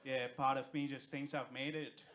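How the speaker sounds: usual speaking voice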